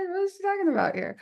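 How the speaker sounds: high, fast puzzled question; mock confused reader. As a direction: play a baffled reader, quick and high. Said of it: "It's like".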